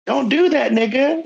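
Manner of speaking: high little-girl voice, lightly imitated; toddler voice. Dad imitating his toddler daughter to grandma, high childish voice. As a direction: mimic a toddler parroting dad. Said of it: "And then, like".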